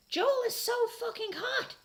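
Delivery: high, slow dreamy swoon; fangirl gush. Acted. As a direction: gush about the singer. Fangirl gushing over singer.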